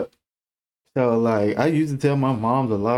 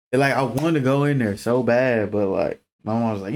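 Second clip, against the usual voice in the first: ordinary talk, nothing marked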